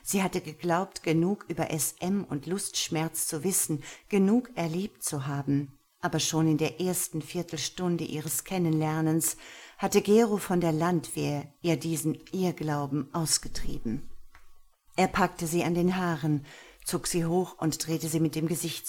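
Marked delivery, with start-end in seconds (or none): none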